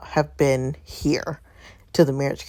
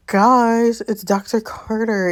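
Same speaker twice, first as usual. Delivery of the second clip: higher, drawn-out excited announcement; real delighted surprise, words stretched out; excited recognition; 5 semitones higher, much slower than usual. Real, not acted. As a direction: share a thrilling discovery with your listeners. Recognises a returning character with delight.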